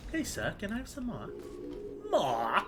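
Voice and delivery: high-pitched pleading English urchin; hammed-up beggar child voice; Oliver Twist. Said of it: "doing an Oliver Twist".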